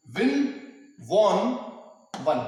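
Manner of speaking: विन, वॉन, वन each said slowly and apart; careful word drill. Shown as a demonstration. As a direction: sound out विन, वॉन, वन clearly. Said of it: "pronunciation में अंतर है". (विन, वॉन, वन को अलग अलग साफ बोलिए)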